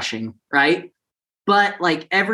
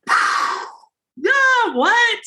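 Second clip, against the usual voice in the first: very high, squealed celebration; re-enacted freak-out. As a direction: act out a stunned, overjoyed shriek. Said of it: "I was freaking out, and he was freaking out".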